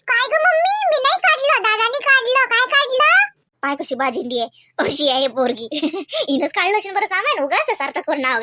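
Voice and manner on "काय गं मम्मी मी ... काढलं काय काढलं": high defensive protest; indignant denial. Real, not acted. Little girl protesting to mom that her brother did it. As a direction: blame brother loudly.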